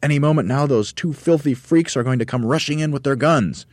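high, loud urgent directing; excited orders. A narrator's reading. Monkey leader directing chair work, excited. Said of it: "I'm so excited".

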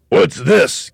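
high, loud, startled outburst; shocked gasp. A narrator's reading. Mr Twit enters the upturned living room, gasping. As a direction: gasp it out in astonishment. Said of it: "gasped Mister Twit".

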